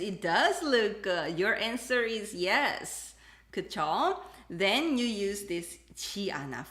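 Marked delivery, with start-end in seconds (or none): none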